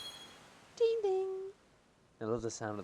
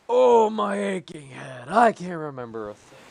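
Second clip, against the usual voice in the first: put-on dazed old man; 5 semitones higher, 11 dB louder than usual.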